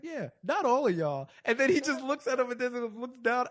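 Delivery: high, loud, laughing through it. Real, not acted. Host laughing while retelling Donovan's comeback. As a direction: giggle through the punchline.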